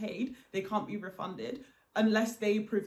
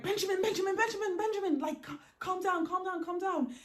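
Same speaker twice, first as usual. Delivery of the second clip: urgent calming; 10 semitones higher than usual. Retold or imitated. Calming friend with fake name.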